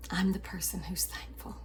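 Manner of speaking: whispered, private and intense. Shown as a demonstration. Telling the family she is thankful, in a whisper. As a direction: breathe it out like a secret vow.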